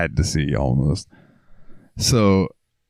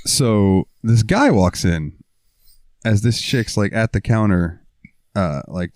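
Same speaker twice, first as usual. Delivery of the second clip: usual speaking voice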